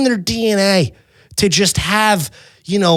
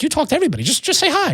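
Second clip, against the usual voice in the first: high, soft imitation of his partner; partner's voice; 10 semitones higher, 4 dB quieter, much faster than usual. Partner nudging him to say hello, high mock voice. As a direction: coax lightly in a high, softer voice. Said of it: "She's like".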